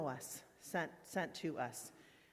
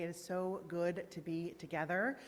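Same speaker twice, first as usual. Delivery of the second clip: normal talking voice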